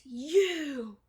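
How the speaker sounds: loud, drawn-out accusing cry; accusing shout. Retold or imitated. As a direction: imitate a crazed accuser calling out. Crazed Ben confronts Thomas.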